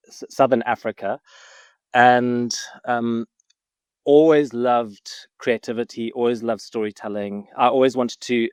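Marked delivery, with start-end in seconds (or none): none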